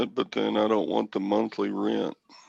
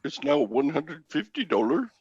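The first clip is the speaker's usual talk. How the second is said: put-on higher Indian-accented agent voice; imitated Indian accent; 4 semitones higher than usual. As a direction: play the agent breaking the bad news in an Indian accent. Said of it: "you could do that accent".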